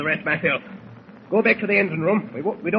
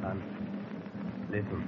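low hushed voice; hushed suspense; 11 semitones lower, 14 dB quieter than usual. Acted. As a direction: keep it hushed and tense. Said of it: "a hushed voice rises".

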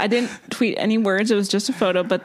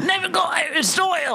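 put-on Cockney accent; 9 semitones higher than usual. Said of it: "Cockney thing".

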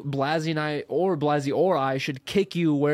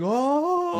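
surprised gasp; 12 semitones higher than usual. Retold or imitated. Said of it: "Blasey going".

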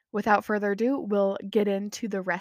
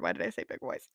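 embarrassed aside; 7 dB quieter, faster than usual. Real, not acted. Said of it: "like, that was weird".

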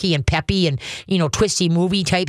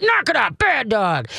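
mock yell at dog; 6 semitones higher than usual. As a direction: bark "Knock it off" up high like an angry owner. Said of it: "want to yell at 'em like".

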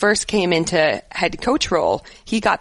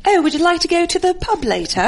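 put-on posh English accent, pitched high; hammed-up Queen's English accent; 11 semitones higher, faster than usual. Asked to show her Queen's English accent. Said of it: "I can do the Queen's English".

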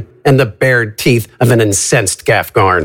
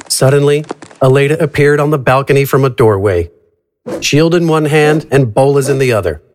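normal talking voice

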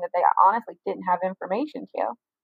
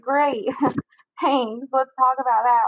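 higher-pitched mock-grateful reply, with a laugh; sarcastic retort; 5 semitones higher, slower than usual. Woman retorting to the officer about his tactless remark, sarcastic. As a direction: throw it back with dry irony.

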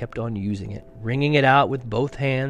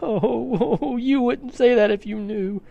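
high, wailing reply; tearful, theatrical protest; between sobs; 11 semitones higher than usual. A narrator's reading. The fawn answers Lucy's kindness, sobbing. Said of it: "replied Mister Tumnus between his sobs".

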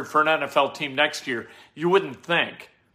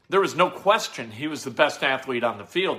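usual speaking voice

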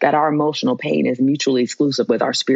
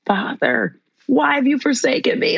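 high pleading cry to the Father; lifted, pleading lament; anguished cry; 8 semitones higher than usual. Retold or imitated. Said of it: "what does he say".

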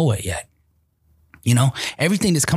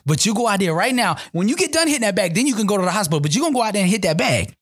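higher, insistent mom voice; a mother's higher, no-nonsense command; voicing his mother; 8 semitones higher than usual. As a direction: play the firm mother ordering her son out. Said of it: "She's like".

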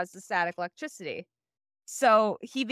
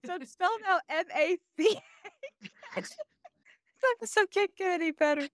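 giggly reading; 9 semitones higher than usual. Real, not acted. Still cracking up reading.

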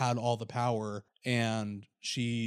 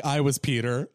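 teasing through a laugh; a joking jab, half laughing; laughing mock quote; 2 semitones higher, 5 dB louder than usual.

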